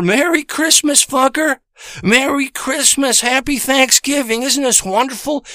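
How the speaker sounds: high, sugary fake greeting; mocking woman's sweet voice at the door.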